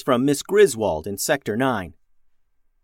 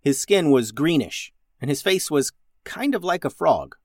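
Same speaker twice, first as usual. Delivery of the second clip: plain reading voice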